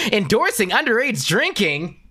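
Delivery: high, loud scandalized viewer voice; shocked viewer. Retold or imitated. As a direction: gasp it out like a shocked stranger. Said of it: "and then be like".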